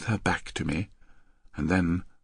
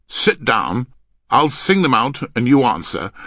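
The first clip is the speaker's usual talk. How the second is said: loud, firm instruction; stern orders; 4 semitones higher, 9 dB louder than usual. Acted. Man making his partner start practice, stern. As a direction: give orders with no room for argument.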